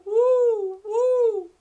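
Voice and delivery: ordinary delivery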